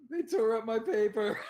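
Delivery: high, loud laughing words. Real, not acted. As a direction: laugh through the line. Laughing at a funny memory.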